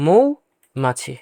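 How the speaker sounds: মৌমাছি very slowly, as "mou... machhi"; diphthong demonstration. Shown as a demonstration. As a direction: stretch the ou of মৌমাছি, then finish the word. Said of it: "উচ্চারণ ও এর মতো".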